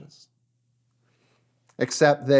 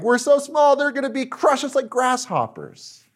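high-pitched panicked wail, acted out; freaking out; 11 semitones higher than usual. Preacher voices spies terrified of giants, freaking out. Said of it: "now they're freaking out like".

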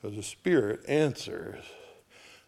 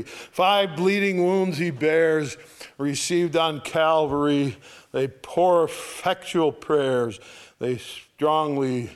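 loud, slow, raised hymn recital; hymn reading; 3 semitones higher, 4 dB louder, slower than usual. Acted. Preacher reads hymn verse three, loud and slow. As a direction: proclaim the verse to the congregation.

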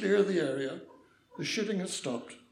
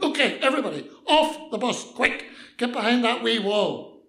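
loud, raised urgent police command; shouted order; 5 semitones higher, 7 dB louder than usual. Acted. Policeman ordering passengers off the bus, shouting. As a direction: shout orders to clear everyone out fast. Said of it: "jumped on the bus again and shouted".

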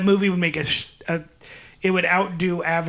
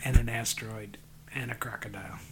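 mock profound whisper; 6 semitones lower, 9 dB quieter than usual. Mock-deep philosophizing.

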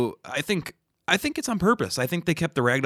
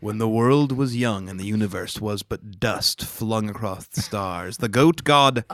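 grave ancient myth telling; 4 dB louder than usual. Pretend. Mock creation myth narration.

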